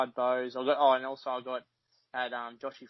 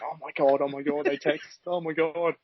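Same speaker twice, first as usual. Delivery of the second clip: mock-excited, a touch louder, hint of schoolgirl gushing; 3 semitones higher, 3 dB louder than usual. Fan mocking himself over a player's text, lightly gushing like a schoolgirl. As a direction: lightly imitate a giddy schoolgirl getting a text. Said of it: "like a sixteen-year-old schoolgirl".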